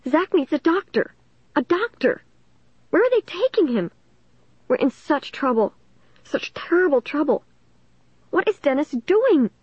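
high-pitched fearful fretting; tiny, frightened voice. A narrator's reading. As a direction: fret in a small, high, scared voice. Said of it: "in a tiny, frightened voice".

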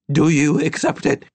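high, loud demand; defiant challenge. A narrator's reading. Old woman challenges the grey figure, defiant.

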